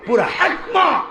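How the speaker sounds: high, shouted preacher's emphasis; ringing, escalating proclamation; emphatic command. Acted. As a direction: punch each phrase out at the top of the voice. God commands Gabriel, voiced emphatically.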